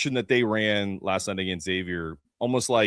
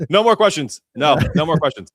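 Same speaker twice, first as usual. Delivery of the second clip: mock shutdown; 7 semitones higher, 9 dB louder than usual. Mimicking press officer cutting off.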